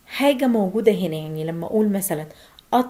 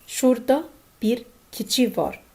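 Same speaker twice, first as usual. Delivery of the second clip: slow demo; 3 semitones higher, much slower than usual. Shown as a demonstration.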